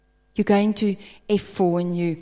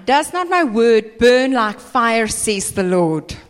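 scripture reading; 7 dB louder than usual. Acted. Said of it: "Jeremiah twenty-three, verse twenty-nine".

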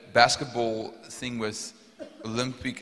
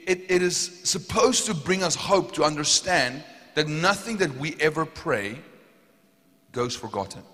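high, loud sermon delivery; impassioned preaching; 6 semitones higher, 7 dB louder than usual. Real, not acted. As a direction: drive every phrase home to the congregation. Preaches on prayers before God.

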